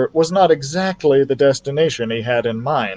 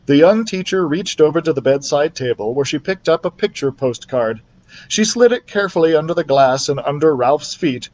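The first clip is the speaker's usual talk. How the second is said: usual speaking voice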